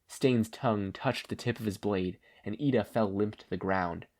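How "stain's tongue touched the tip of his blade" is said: ordinary delivery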